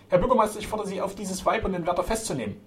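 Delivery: high-pitched outraged appeal; indignant demand. A narrator's reading. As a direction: demand it forcefully, starting loud. Flora demands their arrest.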